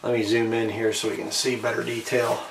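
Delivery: ordinary talk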